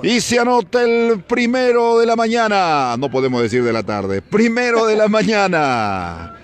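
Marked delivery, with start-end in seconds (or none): 0.0-3.0 breakneck goal commentary. Real, not acted. Goal scored, commentator erupts. 4.2-6.4 excited goal call. Real, not acted. Goal celebration continues.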